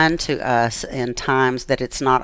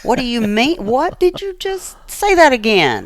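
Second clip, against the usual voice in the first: shocked disbelief; 10 semitones higher, 4 dB louder than usual. Retold or imitated. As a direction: pitch jumps higher, rising in alarm. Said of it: "and I was like".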